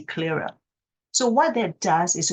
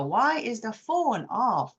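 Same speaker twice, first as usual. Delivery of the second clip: slow one breath; 5 semitones higher, slower than usual. Shown as a demonstration. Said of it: "repeat after the music video".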